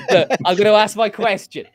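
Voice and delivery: loud, high, through laughter; laughing insistence. Real, not acted. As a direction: push on over your own giggles. Trying to regain control while laughing.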